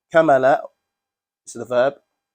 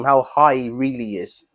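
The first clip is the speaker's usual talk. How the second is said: usual speaking voice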